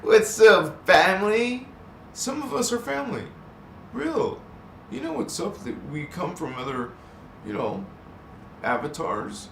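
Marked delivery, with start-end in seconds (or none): 0.0-5.6 high, loud hype welcome; hyped greeting. Real, not acted. Greeting viewers as family, pitched-up hype.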